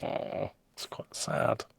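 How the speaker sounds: slow, soft whispered pity; a slow, quiet whisper after the sad punchline. Real, not acted. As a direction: breathe it out slowly, softly.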